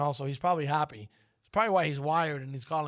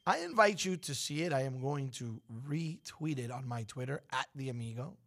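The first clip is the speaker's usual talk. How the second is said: ordinary talk, nothing marked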